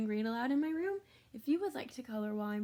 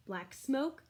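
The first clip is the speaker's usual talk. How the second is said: usual speaking voice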